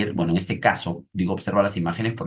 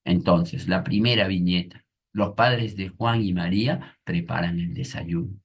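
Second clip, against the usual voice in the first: ordinary delivery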